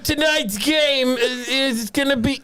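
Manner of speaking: lightly voiced gruff old-timer; a hint of grumpy Archie Bunker. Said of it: "Archie Bunker".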